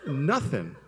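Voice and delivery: husband complaining to his wife, pouty and whiny; pouty complaint. Retold or imitated. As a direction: sulk like a kid denied a hug. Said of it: "I was like".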